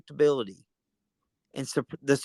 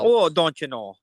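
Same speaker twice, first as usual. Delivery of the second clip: higher, louder Wisconsin lilt; mock Wisconsin accent; 4 semitones higher, 6 dB louder than usual. Cohost joining the bit, mock Wisconsin accent. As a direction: parody a Wisconsin local. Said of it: "for a Wisconsin accent".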